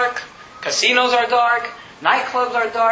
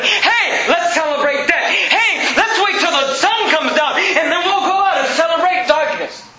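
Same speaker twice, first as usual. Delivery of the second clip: mock party cheer; 7 semitones higher, slower than usual. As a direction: ham up a parent cheering darkness. Mocking Halloween cheer.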